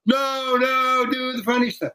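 high, stretched-out mock wail; protesting crowd. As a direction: imitate a crowd calling out in protest.

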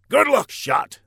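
high, loud general. Acted. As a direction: send him off heartily. General says goodbye.

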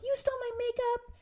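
high whiny accusing voice; whiny sister. Retold or imitated. Mimicking sister's accusation in a high whine. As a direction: accuse her like a bratty kid. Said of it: "And you're like".

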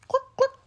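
high clucking; hen clucks. A narrator's reading. Narrator clucking high for the hen. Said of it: "says the hen".

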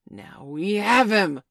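loud, drawn-out, high shout; captain's roused battle cry; triumphant shout. A narrator's reading. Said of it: "Hook shouted".

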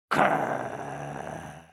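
deep low growl; dog growl. Acted. Dogs chasing monkey, deep growl.

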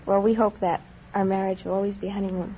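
ordinary talk

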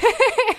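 high, loud laugh bursting out; loud burst of laughter. Real, not acted. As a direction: let a big laugh burst out. Burst of laughter at slow pace.

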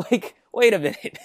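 sudden high, loud wait a minute; surprised double take. Retold or imitated. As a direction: catch yourself, laughing in disbelief. Owen surprised by the droid.

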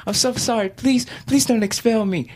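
put-on high, stammered childlike begging; mock-tearful kid saying sorry; tearful pleading. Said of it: "I was crying".

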